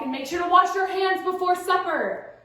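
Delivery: parent calling out. Acted. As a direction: call it across the house to your kid.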